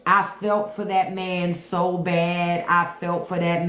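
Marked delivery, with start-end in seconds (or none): none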